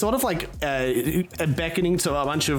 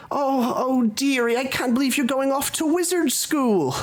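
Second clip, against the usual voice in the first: high, fussy mum voice; doting mother; 11 semitones higher than usual. Acted. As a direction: gush over your child in a high voice. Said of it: "doting on you".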